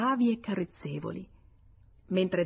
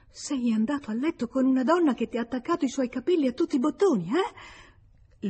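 fast, higher, accusing; jealous exclamation; 6 semitones higher than usual. Acted. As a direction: fire the accusation at him, quick and high. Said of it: "colta da un lieve tremito, esclamò". (esclamazione gelosa, rapida e acuta)

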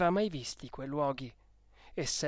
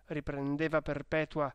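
plain reading voice

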